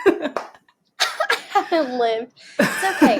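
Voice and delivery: laughing through the words. Real, not acted. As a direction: chuckle it out. Repeating the joke while laughing.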